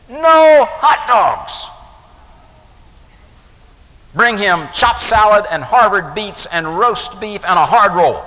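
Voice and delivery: put-on loud, high, commanding mother overruling the boy; stern mother. Mother overriding her son's order to the waitress, loud and stern. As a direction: snap out the order, no arguing.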